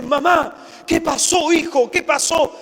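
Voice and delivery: a mother panicking on the phone; anxious mother. Retold or imitated. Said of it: "tu hijo te llama desesperado". (madre angustiada, voz más aguda y fuerte)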